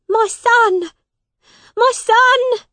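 high, desperate mother's shout. A narrator's reading. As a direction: shout it high and loud to a lost son. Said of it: "she shouted".

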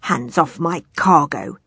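slow, threatening growl. A narrator's reading. Sea trader warns the cabin boy off, growling. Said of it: "he growled at Harriet".

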